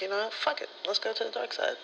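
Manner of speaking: high-pitched mock daring; playing her own reckless self, up high; self-quote. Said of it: "I'm like".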